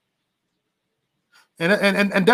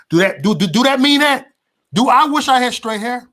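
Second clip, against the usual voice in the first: indignant outburst; 9 semitones higher, 5 dB louder than usual. Real, not acted. Building indignant question.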